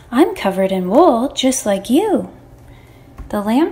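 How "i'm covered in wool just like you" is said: plain reading voice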